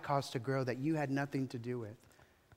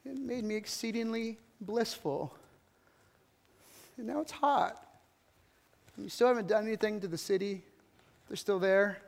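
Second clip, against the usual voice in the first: high, pouting whine; petulant whining child voice; sulky little kid; 4 semitones higher, 4 dB quieter than usual. Acted. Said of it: "like a little kid digging at the dirt".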